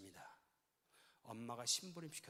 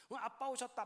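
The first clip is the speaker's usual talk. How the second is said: put-on happy child; 10 semitones higher, 5 dB louder than usual. Child greeting dad gladly.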